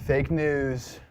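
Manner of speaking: lightly put-on presidential caricature, slow and drawn out; a hint of Trump impersonation; Donald Trump. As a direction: stretch the words in a level, declaring impression. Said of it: "Donald Trump impersonation right there".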